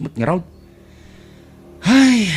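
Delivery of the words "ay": sharp, loud, higher exclamation; a quick startled cry; surprised ay. Acted. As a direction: blurt it out in surprise. Sudden exclamation in conversation.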